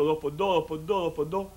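low, soft, repetitive working-out; great-grandfather pondering. Acted. Condemned ancestor struggles with an easy sum, pondering low and soft. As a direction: mull the sum over under your breath. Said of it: "Dice mi abuelo".

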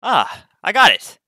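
high, loud delighted burst; pleased surprise, pitch jumping up; surprised. Real, not acted. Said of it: "I was actually surprised".

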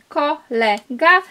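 slow clear reading. Shown as a demonstration. Reading out the hidden word.

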